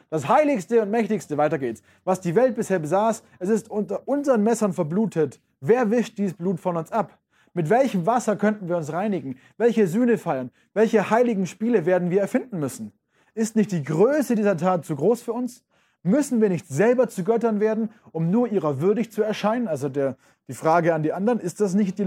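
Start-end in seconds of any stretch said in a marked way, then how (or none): 0.1-1.4 higher, declaiming; dramatic reading. Acted. Teacher resumes the reading. 2.0-19.7 higher, urgent declaiming of questions; dramatic reading. Acted. Teacher reads Nietzsche aloud.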